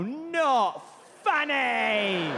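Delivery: imperious, exaggerated dismissal. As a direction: wail the verdict, stretching the last word. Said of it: "does a British billionaire in leather pants go".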